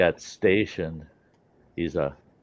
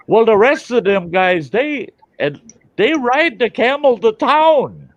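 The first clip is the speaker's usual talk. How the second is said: high, loud, slow punchline; storyteller's loud drawn-out payoff; 11 dB louder than usual. Retold or imitated. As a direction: belt the punchline slowly. Punchline of the joke.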